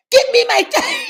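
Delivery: mock shout. Mother grabbing her baby back from the toddler, laughing shout. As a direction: yell it through laughter. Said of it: "I said".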